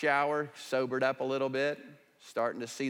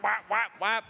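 high, loud playful whap sounds; mock slapping noises; 8 semitones higher, 5 dB louder than usual. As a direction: slap him with your voice, jokingly. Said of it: "I would have whap".

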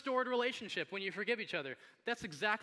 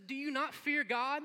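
slow, higher-pitched reproach; rebuking; 7 semitones higher, 4 dB louder, slower than usual. Acted. Criminal to the other criminal, slow incredulous rebuke. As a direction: challenge him, drawing out each word. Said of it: "He gets done rebuking the other criminal".

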